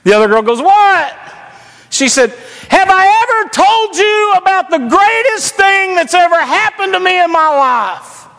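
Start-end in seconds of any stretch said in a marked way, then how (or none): 0.6-1.2 calling back. Retold or imitated. The other girl answers her friend in McDonald's, high yell. 2.7-8.3 high, projected imitation of an excited teenage girl. Girl testifies to her friend across McDonald's, high proclaiming call. Girl's loud public testimony, retold.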